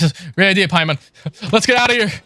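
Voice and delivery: usual speaking voice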